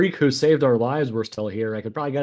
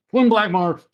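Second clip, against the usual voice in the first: muffled call behind a hand; hand over mouth; 9 semitones higher, 7 dB louder than usual. Acted. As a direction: call out through covered mouth. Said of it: "I put my hand over my mouth and sort of turn away and go".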